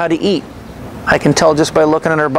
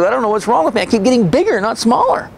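imitating her higher, upset and on the verge of tears; tearful frustration; 7 semitones higher than usual. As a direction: voice her despair, pitched up and tearful. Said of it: "so she started crying".

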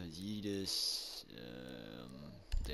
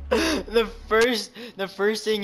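laughing; 14 semitones higher, 18 dB louder than usual. Real, not acted. Laughing over the kill.

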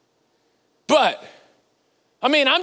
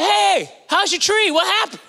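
cheery call-out; 6 semitones higher than usual. Retold or imitated. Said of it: "I said".

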